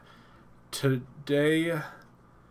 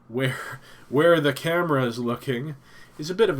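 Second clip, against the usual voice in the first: laughing; 4 semitones higher, 8 dB louder than usual. Real, not acted. Laughs about messy office.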